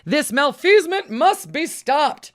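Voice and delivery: Incandesto's catchphrase, slow and grand; superhero voice. Acted. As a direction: proclaim it like a caped hero. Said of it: "what the unassuming janitor Alfred T".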